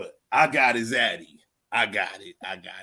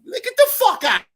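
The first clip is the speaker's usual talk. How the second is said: much higher, louder furious yell; angry shout; 7 dB louder than usual. Real, not acted. Furious outburst at the debater.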